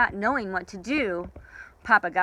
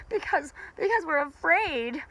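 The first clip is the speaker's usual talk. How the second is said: afraid chorus; 9 semitones higher than usual. Acted. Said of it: "answered in chorus".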